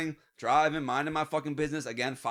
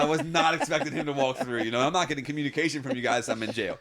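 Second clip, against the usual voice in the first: ordinary delivery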